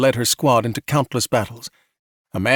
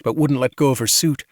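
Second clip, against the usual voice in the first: ordinary delivery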